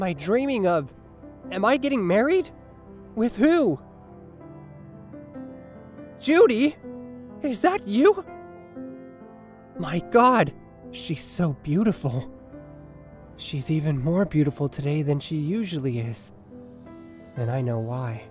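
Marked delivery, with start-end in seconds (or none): 1.5-8.2 high, rising disbelief; astonished dreamer. Acted. Dreamer discovers his bride. 17.4-18.2 low, measured, loving voice; tender wedding vows. Acted. Groom speaks vows in dream.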